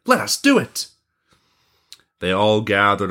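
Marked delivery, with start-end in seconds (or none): 0.1-0.9 high, eager agreement; delighted. Acted. Boys to the prince, high and delighted. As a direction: jump at it in a high boyish voice.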